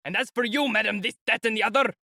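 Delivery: high, angry bellow at the lady; shouted at the top of his voice. A narrator's reading. Lieutenant shouting down the lady. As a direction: roar it at her like a furious officer. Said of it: "he suddenly shouted at the top of his voice".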